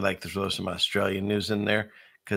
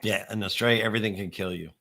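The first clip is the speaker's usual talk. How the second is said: normal talking voice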